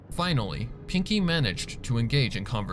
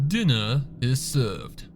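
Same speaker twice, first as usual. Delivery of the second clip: slow, stately butler announcing dinner; measured servant's announcement; formal announcement; slower than usual. Acted. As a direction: draw out the announcement like a grand servant. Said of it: "Prim announced".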